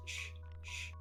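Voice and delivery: usual speaking voice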